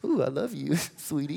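soft, swooning lover's coo; swooning romantic. Retold or imitated. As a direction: coo it like a smitten sweetheart. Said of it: "That's like butterflies, like".

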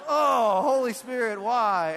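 high, very loud comic wail to heaven; mock anguished cry. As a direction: cry out in hammed-up despair. Mock lament after wife's jab.

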